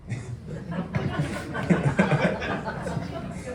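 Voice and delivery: a quiet, airless laugh at her own joke; breathless laugh. Real, not acted. Laughing at her joke about Izzy's bum, breathless giggle. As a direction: let a soft giggle slip out, almost silent.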